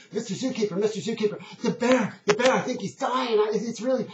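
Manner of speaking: put-on high, urgent child calling out; upset little boy. Boy running up to the zookeeper, upset. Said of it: "the little boy just runs up".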